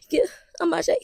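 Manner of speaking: whispered, high whimper; tiny embarrassed crying whisper; mock tearful reply. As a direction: squeak it out through pretend tears. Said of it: "I got tears all down my face".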